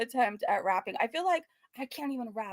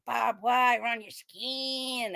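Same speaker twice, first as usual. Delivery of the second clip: slow, dragged-out mock rap line; lightly voiced wannabe rapper; much slower than usual. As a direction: drawl it like a bad rapper. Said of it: "the way he says".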